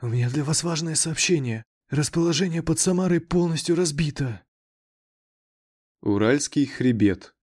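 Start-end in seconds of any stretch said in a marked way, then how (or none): 0.0-4.4 quiet, higher, nervous report; trembling voice. A narrator's reading. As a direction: deliver bad news with a shaking voice. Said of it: "дрожащим голосом".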